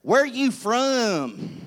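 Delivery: very slow, stretched-out question; mock-amazed, drawling curiosity; delighted store cashier. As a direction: imitate an intrigued cashier, drawing out each word. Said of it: "their eyes would light up".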